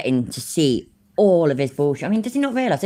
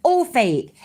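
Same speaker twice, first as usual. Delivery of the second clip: a higher, mock-insistent imitation of him; mocking his claim; 9 semitones higher than usual. Streamer mocking the video host's old denial, higher and sneering. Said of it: "remember when he said the same".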